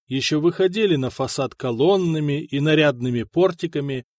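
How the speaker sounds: ordinary delivery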